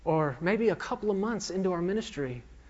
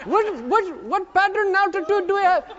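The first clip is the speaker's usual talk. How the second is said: very high, loud yell in an imitated Indian accent; mock screaming in an Indian accent; yelling instructor; 8 dB louder than usual. Flight instructor from India drilling his student over engine noise, yelling. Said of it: "he starts yelling at you".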